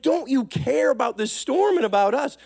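high-pitched, hammed-up worried complaint; mock freaking out. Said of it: "They start freaking out".

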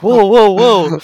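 high, loud nerdy protest; flustered nerd waving them down; high nerdy whoa. Retold or imitated. Counselor stops cheering.

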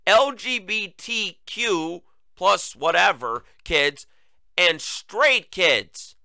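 drawn out slowly, word by word; slow deliberate emphasis. Real, not acted. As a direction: lay each word out slowly for effect. Slowly spells out.

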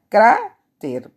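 "cráter" with CRÁ held long, loud and high; stress on first syllable. Shown as a demonstration. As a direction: punch and stretch the CRÁ of "cráter", then drop on "ter". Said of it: "ahí hago el mayor esfuerzo de voz".

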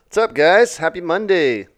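ordinary talk

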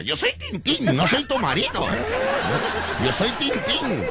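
high, laughing clown reply; hammed-up playful clown; clown's playful voice. Clown answering wife, joking.

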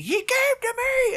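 high-pitched, drawn-out mock scream; imitated scream. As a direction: cry out high without full volume, imitating a desperate boy. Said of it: "is screaming".

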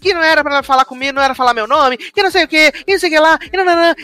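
high, rattled-off mimicked complaint; nonstop monologue. Mimicking her endless monologue to someone, high nonstop complaining. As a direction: imitate someone who will not stop nagging. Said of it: "ela tá num monólogo eterno falando".

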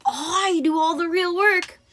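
high, drawn-out indignant complaint; demanding. A narrator's reading. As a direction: protest slowly as an aggrieved snake. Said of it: "George demanded".

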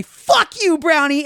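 loud, high yell at the brownie; yelled curse. Retold or imitated. Scene: teen on the deck throwing the brownie, loud high curse. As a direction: hurl the words into the woods. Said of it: "I go on the deck and I say".

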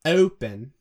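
Irish English vowel in Open. Shown as a demonstration. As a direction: Open with a central, Irish-style O. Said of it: "It's starting from a more neutral position".

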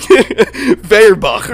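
put-on loud, high, slow German accent. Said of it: "say it with a German accent".

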